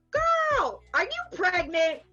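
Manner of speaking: put-on high, loud accented voice of the club man; imitating Sammy. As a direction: do his thick accent, blurting it out. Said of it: "he goes".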